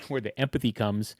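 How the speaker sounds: ordinary talk, nothing marked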